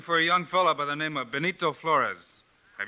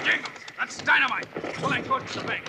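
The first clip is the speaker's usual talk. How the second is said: higher, urgent warning call; alarmed shout; 11 semitones higher than usual. Acted. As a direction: yell it over the rockslide.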